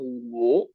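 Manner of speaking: "wo" pushed low and falling. Shown as a demonstration. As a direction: sink the "wo" deep down. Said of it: "Primero va hacia abajo".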